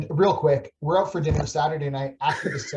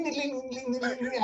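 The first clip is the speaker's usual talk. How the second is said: imitating chimes; 7 semitones higher, 6 dB quieter than usual.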